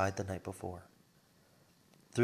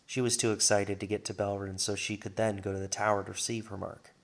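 usual speaking voice

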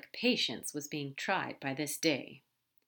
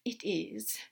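resigned, slightly softer admission; sighing; 4 dB quieter than usual. Acted. Aziraphale confirming miracles to Crowley, resigned. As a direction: admit it with a small sigh. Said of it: "Aziraphale sighed".

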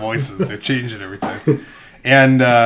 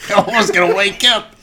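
laughing mock-fretful lady; 10 semitones higher, 7 dB louder than usual.

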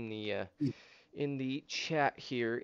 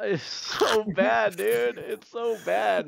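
high, loud laugh through the words; drawn-out wail, cracking up; laughing dismay; 9 semitones higher, 6 dB louder, much slower than usual. Real, not acted. Telling friends about a disastrous date.

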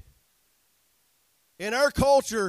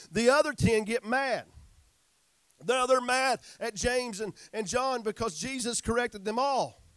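ordinary delivery

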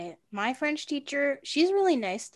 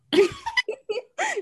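high embarrassed laugh; burst of laughter. Real, not acted. Laughs at own wording.

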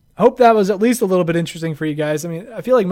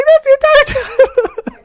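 squeaky voice, much higher and louder; helium balloon voice; 9 dB louder, slower than usual. Pretend. Playing a listener's helium voice request, squeaking. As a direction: squeak it like you sucked helium. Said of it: "Helium balloon voice".

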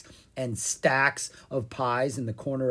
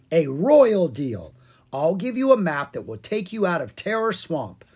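lightly voiced king, higher and louder; bargaining Pumpkin King; 6 semitones higher, 8 dB louder than usual. Pumpkin King offers the lost dragons a deal, grandly. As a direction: proclaim it with a touch of royal pomp.

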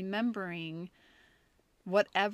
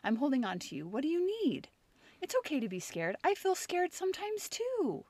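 soft, tender comforting voice, much quieter; soothing; 3 semitones higher, 3 dB quieter than usual. Retold or imitated. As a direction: hold him close with your voice, hushed and gentle. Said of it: "I just was like".

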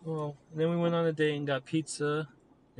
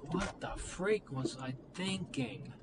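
higher, softer, slow disbelief at yourself; dismayed self-reproach; 6 semitones higher, 7 dB quieter, slower than usual. Retold or imitated. Recalling his mistakes with her, softly and slowly dismayed. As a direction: ask it slowly and softly, baffled at your old self. Looking back, blaming himself.

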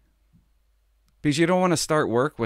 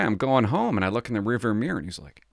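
ordinary delivery

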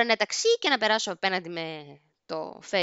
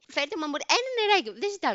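high-pitched, exasperated mock plea; acted pleading; 8 semitones higher than usual. Drunk passenger begging attendant for water, high acted plea. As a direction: beg for it, pitch jumping up.

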